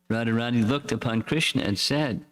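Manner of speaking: ordinary delivery, nothing marked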